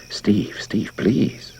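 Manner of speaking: breathless pleading whisper; frightened whisper. A narrator's reading. Wife whispers to her husband, frightened and pleading. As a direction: whisper his name, scared, tugging at him. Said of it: "pulled at his arm with frightened intensity".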